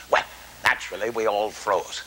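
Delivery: normal talking voice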